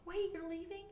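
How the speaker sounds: put-on high, soft little-kid plea; pleading child. Son catching mom sneaking out, high soft plea. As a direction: catch her leaving like a sleepy child. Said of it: "And then I'm like".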